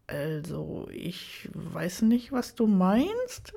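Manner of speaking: slow, hesitant dodge, starting soft; drawn-out, evasive hedging. A narrator's reading. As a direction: stall softly, then lift into an unsure question. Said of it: "Er versuchte auszuweichen".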